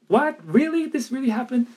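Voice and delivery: high-pitched, amazed disbelief; can't believe it, voice leaping high; overjoyed disbelief. Retold or imitated. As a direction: re-enact being astonished, pitch jumping right up. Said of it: "you know, that feeling of".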